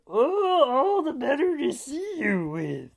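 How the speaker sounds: high falsetto fake granny voice; wolf as grandma. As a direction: coo it in a very high, put-on granny voice. Wolf posing as grandma answers Fred.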